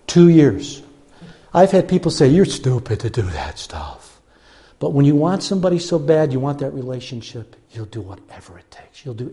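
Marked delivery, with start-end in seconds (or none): 2.3-4.2 low, slow scolding voice; mock disapproval. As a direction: imitate a critic, pitched well down. Imitating critics.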